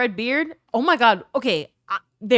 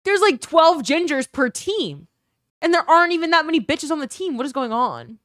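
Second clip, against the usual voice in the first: exasperated rant; 6 semitones higher, 5 dB louder than usual. Retold or imitated. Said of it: "I'm like".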